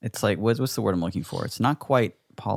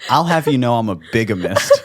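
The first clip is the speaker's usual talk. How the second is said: laughing proud boast; 3 semitones higher, 7 dB louder than usual. Pretend. Mock proud declaration, laughing.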